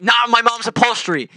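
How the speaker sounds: high, loud protest; a mock outraged cry over mom's upholstery; mock outraged yell. Protesting to save the car seats.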